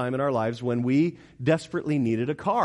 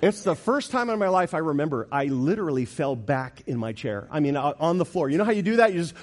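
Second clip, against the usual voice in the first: normal talking voice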